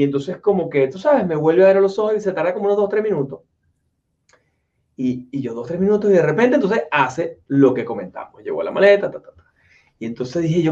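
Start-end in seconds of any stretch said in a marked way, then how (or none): none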